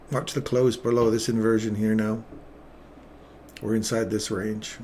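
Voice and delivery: usual speaking voice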